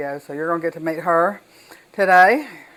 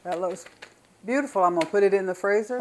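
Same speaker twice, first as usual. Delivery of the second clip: ordinary talk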